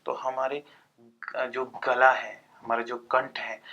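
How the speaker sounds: ordinary talk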